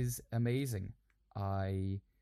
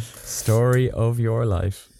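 teasing; 12 dB louder, slower than usual. Acted. Teasing, loud and slow.

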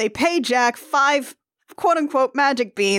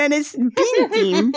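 laughing; 5 semitones higher, slower than usual. Real, not acted. Giggling over the name.

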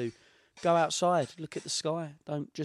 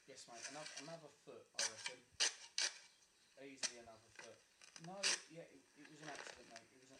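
much softer muttered aside; quiet; 16 dB quieter, faster than usual. Real, not acted. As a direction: mumble it off mic. Mumbled aside after sign-off.

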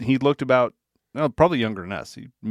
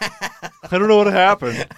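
mock innocent; 8 semitones higher, 6 dB louder, slower than usual. Mocking arrival after the search.

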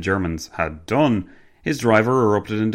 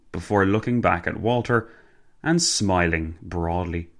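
plain reading voice

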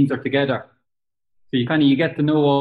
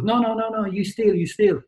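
angry manager; 7 semitones higher than usual. Retold or imitated. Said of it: "And he goes".

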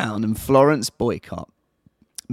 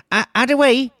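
higher and louder, a hammed-up old granny; mock old lady; 8 semitones higher, 5 dB louder, faster than usual. To her co-host, pretending to be a hundred-year-old lady, higher and louder. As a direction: play a toothless old lady reporting her day. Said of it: "Put your teeth back in".

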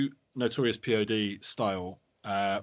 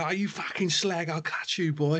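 slow, lightly voiced East End geezer threat; a hint of a hard man squaring up, slowly; imitating Chip in a gangster-film style; mock Chip threatening; 5 semitones higher than usual. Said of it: "Like, Chip's there".

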